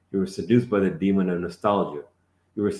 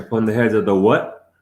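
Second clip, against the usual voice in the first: louder rising question; challenging question; 2 semitones higher, 7 dB louder than usual. Real, not acted. Teacher demanding the student name the creature. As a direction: demand the word.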